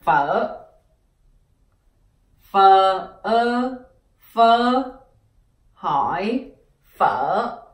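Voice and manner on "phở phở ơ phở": phở said slowly and clearly, Vietnamese tone; teacher models the word phở for learners. Shown as a demonstration. Teacher showing students how to say phở. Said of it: "that's how you pronounce phở in Vietnamese".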